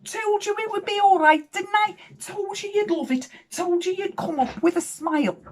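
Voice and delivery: very high-pitched crowing I-told-you-so; gloating. Retold or imitated. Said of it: "Did I gloat?".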